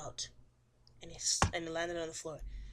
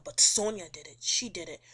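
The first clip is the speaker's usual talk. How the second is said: loud accusation; 8 dB louder than usual. Retold or imitated. Luzin accusing Sonia in retelling.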